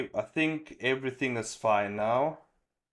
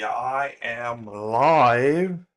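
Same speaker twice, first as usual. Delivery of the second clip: stretched out very slowly, a bit louder; drawn-out announcement; 8 dB louder, much slower than usual. Real, not acted. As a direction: announce it like a showman, drawing every word out. Stream just started, announcing live.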